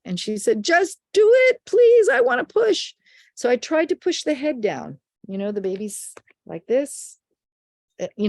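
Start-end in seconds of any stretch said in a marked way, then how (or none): 0.6-2.9 high, loud begging, imitating a mother in labour; desperate plea. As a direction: plead urgently at high pitch. Retold mother pleads in labour.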